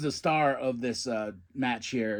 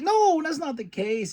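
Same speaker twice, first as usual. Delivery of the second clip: much higher, squeaky reassurance; high mock voice; 13 semitones higher, 3 dB louder than usual. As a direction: squeak it out playfully. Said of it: "She's like".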